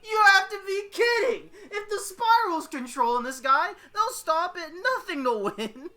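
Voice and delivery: high, loud panicked outburst; shrieking in disbelief and alarm; alarmed realization. Acted. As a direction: cry out as dread hits. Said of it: "Maka's eyes widen at the realization".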